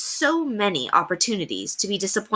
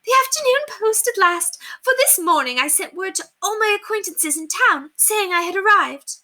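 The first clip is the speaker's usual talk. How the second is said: high, excited society lady voice; gushing grand lady; 11 semitones higher than usual. A narrator's reading. Lady telling her maid about expected invitations, high gushing voice. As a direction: gush over all the invitations to come.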